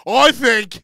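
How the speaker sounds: loud, high put-on English judge. Imitating a judge giving his verdict, loud and grand. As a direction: blurt it out as a mock British judge, high and loud. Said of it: "He was like".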